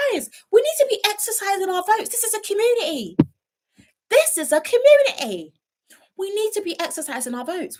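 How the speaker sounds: high, hammed-up civic sermon voice; mock preachy lecture. Streamer mock-scolding viewers who skipped the vote, high preachy voice.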